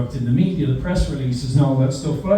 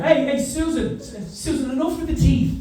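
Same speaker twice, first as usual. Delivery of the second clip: exasperated teacher; 11 semitones higher than usual. Retold or imitated. Teacher scolding child.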